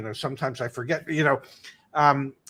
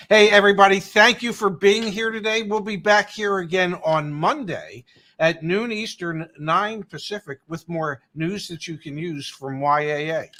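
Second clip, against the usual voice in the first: big radio announcer close; booming sign-off like a DJ; 3 semitones higher, 4 dB louder than usual. Acted. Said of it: "I even put my best radio voice into that one".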